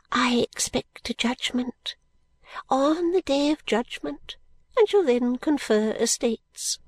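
high, slow, starting in a whisper; an old woman sharing her dream; fluttery old lady. Acted. Miss Flite confides her hopes, high and slow.

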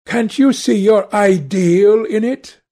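high, loud, bitter challenge. A narrator's reading. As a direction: throw the question out with mild bitter scorn. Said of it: "said Dorian bitterly".

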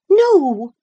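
high, loud, stubborn cry; insistent refusal. A narrator's reading. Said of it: "she persisted".

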